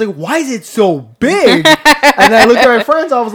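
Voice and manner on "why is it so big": high, loud, slow disbelief; retold shock. Teenage self asking his friend about it, loud astonishment. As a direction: stretch it out in astonishment. Retelling own shock at the sight.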